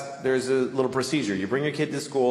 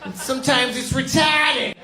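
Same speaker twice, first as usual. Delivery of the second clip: Boston teacher; 11 semitones higher than usual. Retold or imitated. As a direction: lecture the kids like a brassy Boston teacher. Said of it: "She's like".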